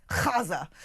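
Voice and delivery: "Gaza" with an exaggerated throaty kh; mock pseudo-Arabic rasp at the start of "Gaza"; mock Arabic pronunciation. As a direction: sneeringly scrape out "Gaza" as KHAH-za. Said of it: "weird pseudo-Arabic action, uh, accent".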